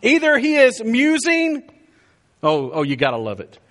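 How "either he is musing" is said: high, drawn-out taunt; mocking. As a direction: tease them in a high, slow voice. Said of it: "Elijah mocked them".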